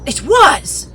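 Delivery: loud, high excited shout; excited cry. A narrator's reading. Silver recognising the blind man's name, excited cry. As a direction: burst out with eager recognition. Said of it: "cried Silver, now quite excited".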